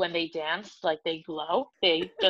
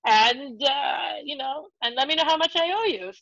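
higher-pitched imitation of a Spanish-accented dad; 5 semitones higher than usual. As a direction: repeat the request in dad's Spanish accent. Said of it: "so he has a great accent".

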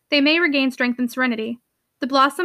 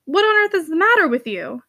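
higher, swooping incredulous question; exasperated concern; 4 semitones higher than usual. Acted. Uncle shocked at his niece's wan look, exasperated. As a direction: demand to know, baffled.

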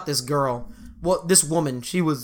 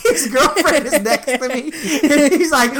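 ordinary delivery

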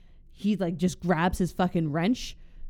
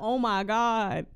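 slow, higher mock-horrified bystander; random civilian voice; 5 semitones higher, much slower than usual. Repeating the onlooker's reaction for laughs, random civilian voice. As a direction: drag it out high like an appalled onlooker. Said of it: "random civilian voice".

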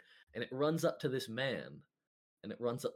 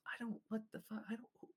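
baffled president; 5 semitones higher than usual. Acted. Steve McKing baffled, voiced.

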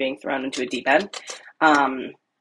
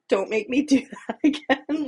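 put-on higher, pleading little-kid plea with a laugh; sad, pleading; 7 semitones higher than usual. Child pleads with mom after the phone call, sad and pleading. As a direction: beg like a kid with puppy dog eyes. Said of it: "so sad with, like, puppy dog eyes".

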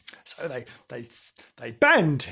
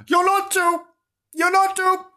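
high, loud scared mewing; frightened mew; 4 dB louder than usual. Acted. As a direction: mew in panic. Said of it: "mewed Bernard".

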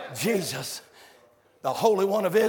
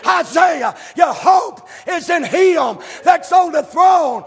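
loud sung whoop of a preacher at full cry; full-force preaching; 9 semitones higher, 8 dB louder than usual. Real, not acted. Preacher whooping the congregation about the throne, loud and sung. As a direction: preach it out, half singing, building to the top.

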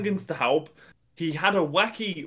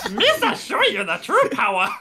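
high goofy Gungan voice, louder; Jar Jar voice; 8 semitones higher, 4 dB louder than usual. Pretend. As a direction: declare it like a silly dark lord. Jar Jar as Sith.

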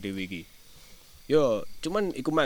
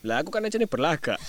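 ordinary talk, nothing marked

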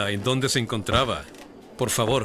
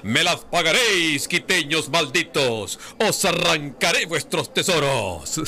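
slow, raised, hammed-up villain threat; sputtering foiled villain; 5 semitones higher, slower than usual. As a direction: ham up a cursing stage villain, slowly. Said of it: "El comediante lo hacía mejor que yo, pero más o menos era como".

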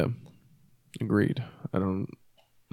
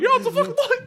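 cartoon voice; 5 dB louder, much faster than usual. Echoing the cartoon voice.

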